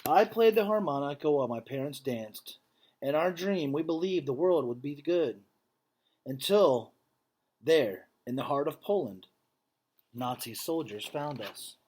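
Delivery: earnest, measured read-aloud voice; story narration. A narrator's reading. Teacher reading a picture book to students, earnest storytelling. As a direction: read the story to the class with feeling.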